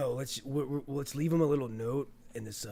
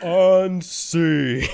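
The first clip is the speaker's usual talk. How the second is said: a sudden loud pun; loud punchline; 4 semitones higher, 9 dB louder than usual. Acted. Scene ends, he shouts the pun.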